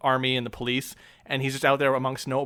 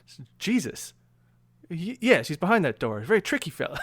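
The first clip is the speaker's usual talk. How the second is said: minister voice; 4 semitones higher than usual. Retold or imitated. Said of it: "and just says".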